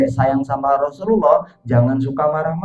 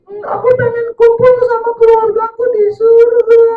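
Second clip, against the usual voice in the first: suara anak kecil tinggi dan sedih; 4 dB louder than usual. Acted. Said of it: "Yang nangis anak kecil". (suara anak kecil yang menangis)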